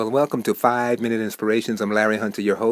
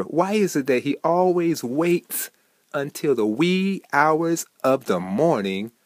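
ordinary talk

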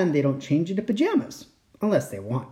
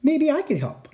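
lightly acted storybook shout, pitch raised; mild shout; 10 semitones higher than usual. A narrator's reading. Child offering help over the wind, mild high shout. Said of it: "I shouted over the wind".

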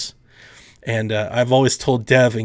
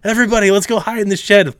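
rising higher and higher into frustration; exasperated logical guy; 7 semitones higher, 4 dB louder than usual. Retold or imitated. The ignored logical guy pleads with the group, building to exasperation. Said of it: "I'm like".

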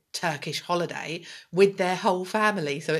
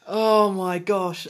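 shocked; 5 dB louder, much slower than usual. Real, not acted. Shocked reaction.

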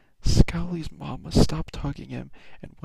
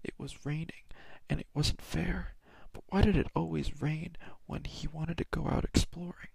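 whispered story; 3 semitones higher, 3 dB quieter than usual. Acted. Whispered bedtime story reading.

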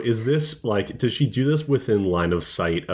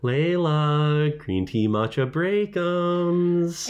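sing-song call; 8 semitones higher, much slower than usual. Retold or imitated. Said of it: "you hear Anais with the".

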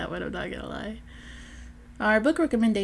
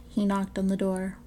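plain reading voice

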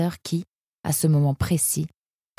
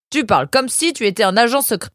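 higher-pitched reproach; exasperated jibe; 7 semitones higher, 4 dB louder than usual. A narrator's reading. Family challenging the departing son, exasperated. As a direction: needle them with a raised pitch.

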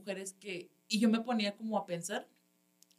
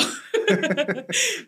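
burst of laughter; 9 semitones higher, 8 dB louder than usual. Real, not acted. Laughing on stage.

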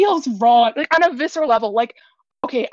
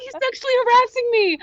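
mocking whiny quote; 9 semitones higher, faster than usual. Mocking a DM complaint.

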